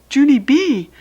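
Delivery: a mom alarmed from the back door; worried mother calling. Acted. Mother calling out to Junie B from the door. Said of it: "she called".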